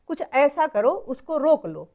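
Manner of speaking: usual speaking voice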